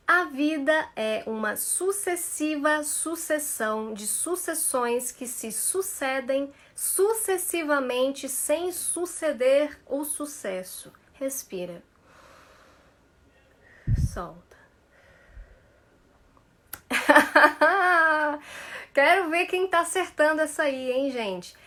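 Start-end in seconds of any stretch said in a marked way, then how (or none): 0.1-10.9 slow, deliberate syllables; slow tongue twister. Shown as a demonstration. Teacher reciting a tongue twister slowly for students. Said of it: "começar a falar devagar". 16.7-18.9 burst of laughter. Real, not acted. Laughs at own twister.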